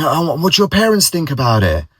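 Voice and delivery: colleague, hooked on the story; keen question. Retold or imitated. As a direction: lean in with interest. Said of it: "he was saying".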